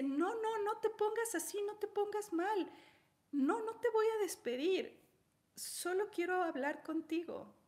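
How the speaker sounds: high nervous placating voice; doctora cediendo. Retold or imitated. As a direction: soothe her in a high worried voice. (doctora apaciguando, aguda y nerviosa)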